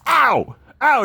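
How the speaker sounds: high-pitched "Ow!" yelps; imitated pained scream. Billy getting stung by yellow jackets, screaming. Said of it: "He starts screaming".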